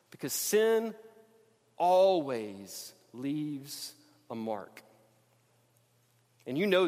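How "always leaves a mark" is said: each word set apart, very slow; slow refrain. Acted. Preacher to congregation, very slow weighty refrain. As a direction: drag it out so it lands.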